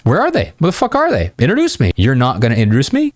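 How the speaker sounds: high-pitched playful indignation; hammed-up affronted disbelief; mock offended. Shown as a demonstration. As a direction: demand introductions as if slighted. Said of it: "Almost like you're offended".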